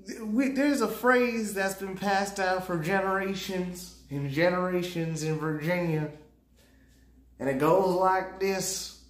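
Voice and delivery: put-on a lightly done old Virginia storyteller. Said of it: "It's supposed to be a Virginia voice".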